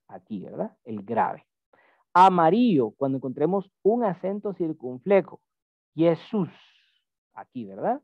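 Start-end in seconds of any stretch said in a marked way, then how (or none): none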